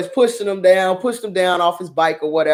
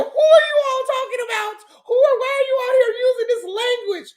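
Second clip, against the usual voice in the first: very high, prim scandalized voice; the proper lady outraged at the language; shocked proper mother. Retold or imitated. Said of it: "my mother comes out there".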